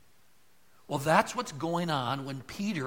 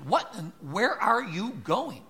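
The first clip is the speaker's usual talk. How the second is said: higher, slow mock mom question; mother asking; 7 semitones higher, 4 dB louder, slower than usual. Preacher recalling a mother questioning her child, slow and higher. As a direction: lightly play a suspicious mother. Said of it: "I heard a mother ... ask that question".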